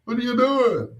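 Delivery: retold little boy's complaint about a baby brother; kid complaining to mom. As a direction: whine at mom like a disappointed little boy. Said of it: "I'm like".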